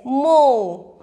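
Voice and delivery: मो loud, with the "oh" held; teacher's model मो with a stretched "oh"; long O sound. Shown as a demonstration. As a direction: draw out मो as "mohh". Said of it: "क्या उच्चारण निकलेगा इसका".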